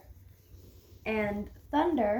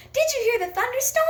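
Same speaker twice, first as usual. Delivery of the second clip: high, fast and loud, an excited shout; squealed with excitement, much higher and louder; excited exclamation; 13 semitones higher, 6 dB louder, faster than usual. Shown as a demonstration. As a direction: fire the question off like exciting news. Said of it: "Well, I'm not excited about it".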